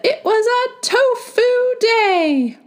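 very high drawn-out chant; a silly chorus sing-song; high, slow sing-song. A narrator's reading. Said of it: "they all said".

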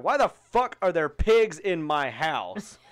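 loud, slow grandma yell; angry grandma yell. Retold or imitated. Grandma yelling at noisy grandkids, loud and slow. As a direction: bellow at the kids like grandma. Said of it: "she'd be like".

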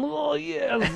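high, hammed-up Russian accent. Shown as a demonstration. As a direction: slip into a playful Russian accent. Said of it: "I guess I went Little Russian".

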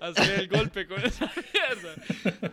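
slow words pushed through real laughter; can barely get it out for laughing; cracking up mid-sentence. Real, not acted. Laughing retelling a gross moment.

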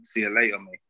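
normal talking voice

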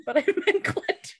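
laughing through the words; giggling out clenching. Real, not acted. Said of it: "I swear to God".

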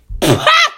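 short high laugh; real amused laugh; high burst of laughter. Real, not acted. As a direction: laugh in disbelief. Laughs at creep story.

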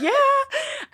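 drawn-out, very high squeal of yes; retold excitement. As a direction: squeal it eagerly, laughing at your old self. Acting out her eager yes.

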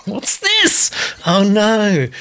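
very high, hammed-up squeal; mock horror. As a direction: cry out in comic dismay, pitch shooting up. Repeats viewer's comment in mock horror.

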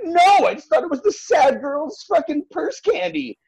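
high, laughing disbelief; incredulous retort. Retold or imitated. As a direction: protest it through rising laughter. Retelling her shocked reply.

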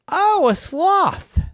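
high, loud, drawn-out oh; light up at a wonderful idea; loud, slow swoop of interest; delighted realization. A narrator's reading. Girl takes to brother's suggestion.

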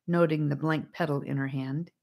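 ordinary delivery

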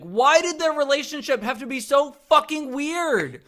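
high, loud exasperation; loud, high-pitched complaint; exasperated rant. Real, not acted. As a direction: burst out in disbelieving frustration. Exasperated rant about the films.

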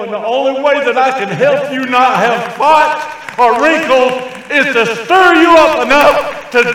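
high, loud pulpit exhortation; full-force preaching. Real, not acted. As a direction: build to an impassioned preacher's shout.

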